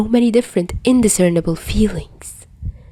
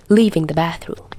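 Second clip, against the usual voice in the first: usual speaking voice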